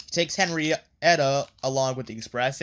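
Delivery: ordinary talk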